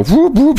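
high mock siren whoop; imitating a patrol car siren.

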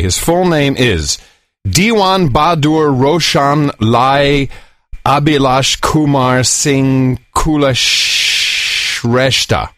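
slow, careful Indian name read syllable by syllable; slow, deliberate name recital; careful full-name reading. Shown as a demonstration. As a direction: spell out every part of the long name slowly. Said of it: "he wants us to try to pronounce his full name".

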